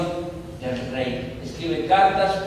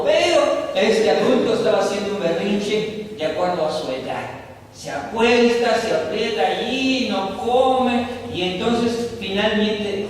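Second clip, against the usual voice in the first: louder, higher preaching push; fervent preaching; 4 semitones higher, 4 dB louder than usual. Acted. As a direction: drive the point home to the congregation. Preacher builds the tantrum point. (predicación enérgica, más alta y fuerte)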